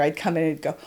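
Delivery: ordinary delivery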